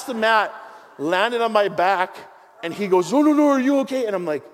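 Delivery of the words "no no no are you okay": loud, higher worried check on him; alarmed coach. Retold or imitated. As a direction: call out in alarm to the fallen student. Jiu-jitsu coach after throwing him.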